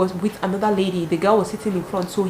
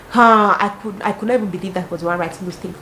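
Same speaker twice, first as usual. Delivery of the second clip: normal talking voice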